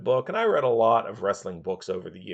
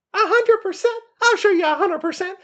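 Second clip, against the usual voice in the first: much higher, louder mocking sneer; Roddy Piper impression; 7 dB louder than usual. Piper scoffing at the wrestler's promise, high loud mock voice. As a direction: throw his words back at him, scornful. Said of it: "and he's like".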